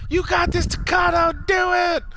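very high imitation of a teacher; hammed-up high-pitched rooting; mock cheering shout. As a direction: holler encouragement like a frantic teacher.

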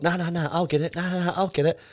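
very high mimicry of insistent mates; imitating the boys' showy generosity; mock offer to pay. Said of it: "the boys did the old".

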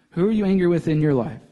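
normal talking voice

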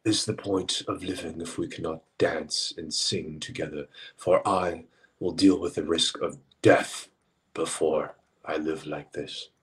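mock-grand poetic declaration; lightly theatrical lament; poetic romantic. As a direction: recite it like a romantic poet. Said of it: "posed in such a poetic and romantic manner".

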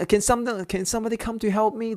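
playfully imitating a boy yelling for help; hammed-up help cry; mock cry for help. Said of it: "说".

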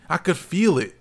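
ordinary delivery

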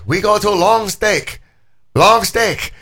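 put-on high, drawn-out Chinese-accented girlfriend; mock Chinese accent. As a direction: imitate a Chinese accent, pitched high and slow. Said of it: "So she's like".

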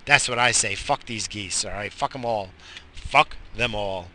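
ordinary talk, nothing marked

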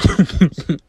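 loud, high laugh breaking out; fail to hold the laugh in; burst of laughter. Acted. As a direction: friend bursting out laughing at Claire's mom story. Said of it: "Reagan tries to hold her laughter but can't".

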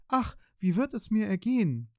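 high, anxious and near tears; a frightened wife near tears; tearful fear. A narrator's reading. A wife frightened after the broken pots, tearful and high. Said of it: "Sie fing an zu weinen und wusste vor Angst nicht".

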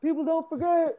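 imitating a wounded grudge-holder; movie character line. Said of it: "He's like".

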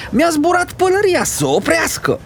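very high, yelled, stamping feet; boy howling that his hat flew off; bawling. Acted. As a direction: wail over the lost hat. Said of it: "zbiară și mai tare Goe".

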